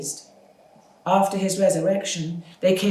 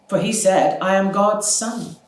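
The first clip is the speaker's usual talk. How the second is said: mocking taunt; 5 semitones higher, 7 dB louder than usual. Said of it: "were mocking him".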